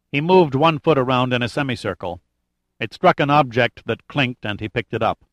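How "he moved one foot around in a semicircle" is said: usual speaking voice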